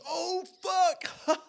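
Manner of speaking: very high, loud scream with cackling; full-blast holler ending in a whoop; top-of-lungs yell. Retold or imitated. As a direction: imitate a friend screaming in wild exhilaration. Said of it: "at the top of his lungs".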